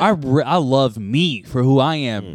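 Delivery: loud, high hammed-up hype; hyped self-love. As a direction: gush over yourself loudly.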